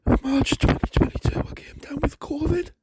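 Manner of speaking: wheezing and out of breath between words; gasping for air while talking, really ill; wheezy voice. Real, not acted. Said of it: "I'm constantly wheezing".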